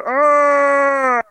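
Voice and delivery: loud, high open-mouthed yell. Retold or imitated. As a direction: yell with mouth wide open and teeth showing. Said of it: "it just sounds like they're going".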